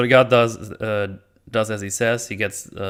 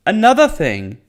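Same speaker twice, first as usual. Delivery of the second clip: bursting out with the next point; excited outburst; 10 semitones higher, 7 dB louder than usual. Real, not acted. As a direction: jump in eagerly, higher and louder. Suddenly loud new point.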